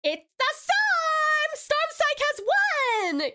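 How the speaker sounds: high, drawn-out cheer for the winner; big, high-pitched victory call; excited winner announcement. Pretend.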